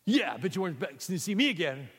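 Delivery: high, loud, fast retort; George, indignant. Acted. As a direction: snap back at him. George, smug and angry.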